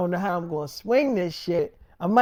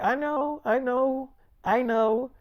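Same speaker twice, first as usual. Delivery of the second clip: a higher, repeated "I know" that settles back down; girlfriend's insistent voice; 6 semitones higher, slower than usual. Retold or imitated. Girlfriend cutting him off, higher voiced. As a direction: play the girlfriend brushing off his point.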